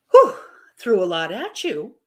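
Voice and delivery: normal talking voice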